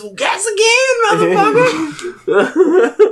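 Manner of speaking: very high-pitched mock threat; hammed-up taunt. Player taunting his attackers, hammed-up taunt. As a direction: throw it out high, as a mock action hero.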